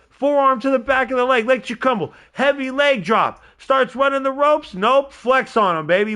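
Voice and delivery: loud, high wrestling commentary; excited play-by-play. Real, not acted. Streamer calling the match moves for viewers, fired up and loud. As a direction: call each move high and loud.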